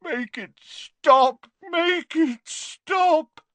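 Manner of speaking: slow, high anguished moan; tormented pleading, pitched very high. A narrator's reading. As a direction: beg in a long, drawn-out wail. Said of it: "moaned Dumbledore".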